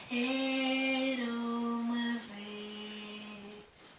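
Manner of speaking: very slow, soft, dreamy; drawn-out soft once upon a time; slow echoey. Acted. Repeated opening. (muito lento e suave)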